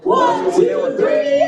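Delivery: loud, high count-off; rallying count-in; shouted count. Real, not acted. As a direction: shout it out to the whole crowd. Crowd count-off to call guests.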